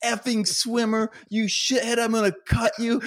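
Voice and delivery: high, hammed-up furious coach; mock cursing coach. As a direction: curse him out like a coach gone wild. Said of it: "dog cursing me".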